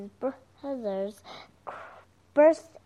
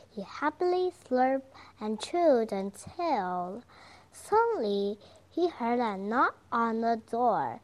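usual speaking voice

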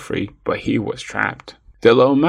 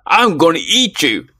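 loud, higher-pitched lion roar; 6 semitones higher, 4 dB louder than usual. A narrator's reading. Lion threatening the little mouse it grabbed, roaring. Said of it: "roared the lion".

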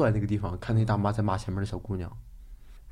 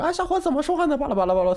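much higher, shrill-edged mock outrage; indignant auntie; faster than usual. Auntie scolding the young man on the bus, mock indignant. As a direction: bristle like an offended old woman. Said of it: "然后那大妈说".

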